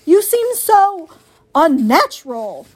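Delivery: screechy owl recoiling from something strange; screeching owl. A narrator's reading. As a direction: draw it out, high and appalled. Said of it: "screeched the owl".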